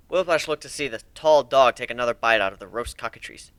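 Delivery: normal talking voice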